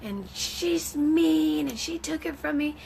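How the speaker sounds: put-on high, slow, whiny little boy; mock toddler complaining to mommy; sulky child. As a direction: sulk like a hurt three-year-old. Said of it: "he's like".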